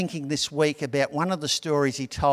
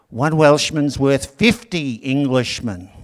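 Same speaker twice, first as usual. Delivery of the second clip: put-on Welsh accent; 6 dB louder, slower than usual. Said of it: "I can't do a Welsh accent".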